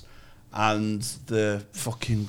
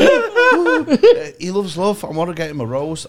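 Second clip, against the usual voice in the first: high, loud bird voice through laughter; laughing nightingale; 7 semitones higher, 10 dB louder, slower than usual. Pretend. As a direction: squeal it while still laughing.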